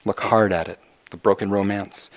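usual speaking voice

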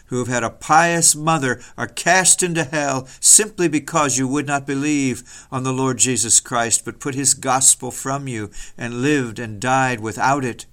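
ordinary talk, nothing marked

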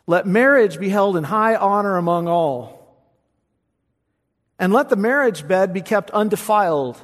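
proclaimed scripture. Acted. Preacher proclaiming the marriage verse to his congregation, loud. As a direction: declare it out loud with force.

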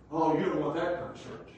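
ordinary talk

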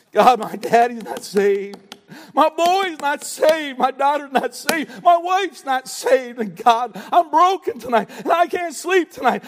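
fervent prayer. Acted. Believer crying to God for unsaved family, fervent prayer. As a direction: pour it out to God at full force.

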